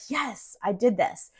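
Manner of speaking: soft, breathy whisper, a touch slow; whispered triumph. Retold or imitated. To herself after driving to the airport, a whispered yes. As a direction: hush the win to yourself.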